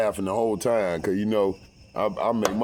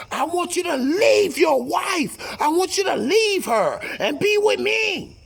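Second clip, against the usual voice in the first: mistress shout; 4 dB louder than usual. Retold or imitated. Mistress on the phone ordering him to leave his wife, high loud wail.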